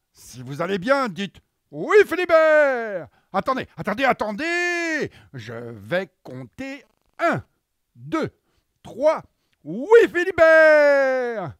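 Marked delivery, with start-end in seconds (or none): 1.7-3.1 high, slow, loud clown call; silly clown shout. Acted. Kids prompted to shout reply. 3.3-5.1 silly clown. Acted. Clown stops the crowd. 9.6-11.6 high, very slow, loud clown cheer; silly clown shout. Acted. Kids' reply shouted with them.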